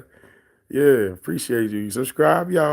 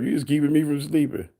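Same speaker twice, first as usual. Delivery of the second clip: mock voice; 3 semitones higher than usual. Shown as a demonstration. Streamer mocking a chatter, in a light teasing voice. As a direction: repeat it in a lightly put-on mocking voice. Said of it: "repeated in put-on voice".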